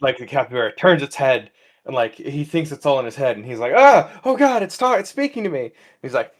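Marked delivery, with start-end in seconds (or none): 3.8-5.7 high, loud mock-panic outburst; panicked. Man finds the capybara is speaking to him, mock panic. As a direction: yelp in comic alarm, easing off at the end.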